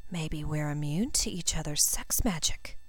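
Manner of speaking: hushed and breathy, nearly whispered; a bit breathless. A narrator's reading. Stunned girl to Draco, hushed and breathless. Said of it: "a bit breathlessly".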